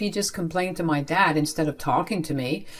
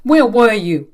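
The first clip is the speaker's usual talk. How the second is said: loud, raised-pitch angry question; angry demand; 4 semitones higher, 9 dB louder than usual. A narrator's reading. Angry father to child back from library, sharp demand. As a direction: demand an answer, loudly. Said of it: "Dad was very angry".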